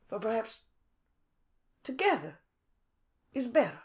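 slow, grand, flowery delivery; cursive, drawn-out phrasing. Acted. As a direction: savour each word like fine handwriting. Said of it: "Sorry, I said that in cursive".

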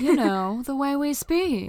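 high, slow, airy starlet voice; a lilting old Hollywood starlet. Retold or imitated. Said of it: "The way you talk is very melodic".

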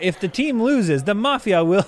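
high Italian chef voice; Italian accent. Acted. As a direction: fear it in Italian. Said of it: "both boys speak in thick Italian accents".

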